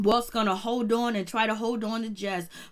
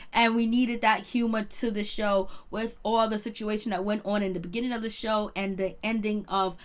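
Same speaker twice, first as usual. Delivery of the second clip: normal talking voice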